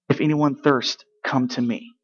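ordinary talk, nothing marked

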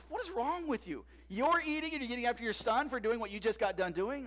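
high, loud retold scolding, hammed up; mock rebuke. Friend rebuking the pastor for eating before prayer, loud mock scold. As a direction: call out the hypocrite, playfully indignant. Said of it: "And Doug's like".

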